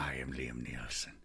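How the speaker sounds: breathy unvoiced whisper, low and soft; whispered mock introduction. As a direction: hush it like a secret.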